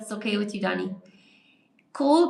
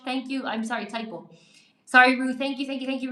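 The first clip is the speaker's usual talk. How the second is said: normal talking voice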